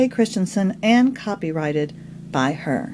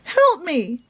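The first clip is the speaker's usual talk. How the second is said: faint mouse voice; 4 semitones higher than usual. A narrator's reading. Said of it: "came a faint mouse voice".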